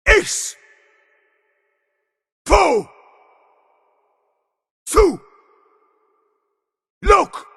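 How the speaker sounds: high, explosive single-word shouts; full shout. Acted. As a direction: hurl each word out, pitch falling away. Isolated battle shouts in game.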